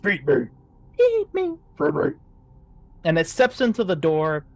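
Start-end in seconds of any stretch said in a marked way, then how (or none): 0.0-0.5 a hint of a deeper monster voice; lightly voiced deeper head. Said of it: "a deeper voice of". 1.0-2.1 higher, louder pleading creature; shrieking hungry head; soft monster voice. Acted. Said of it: "a softer voice of".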